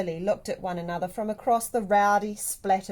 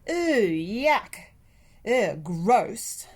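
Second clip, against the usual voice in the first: louder hammed-up "ooh, yuck" and "ugh, gross"; exaggerated disgust; 6 dB louder, much slower than usual. A narrator's reading. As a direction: pull a face and groan it. Girls voicing disgust at each other.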